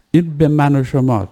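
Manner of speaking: ordinary delivery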